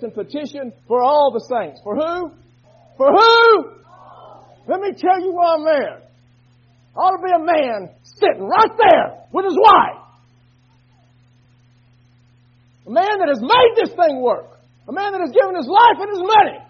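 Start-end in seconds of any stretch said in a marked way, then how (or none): 3.0-3.7 much higher and louder, belted; shouted question. Acted. Preacher demanding an answer from the church, loud high shout. 4.7-16.6 louder, higher preacher's shout; full-force preaching. Acted. Preacher angry over a missing man, shouting from the pulpit.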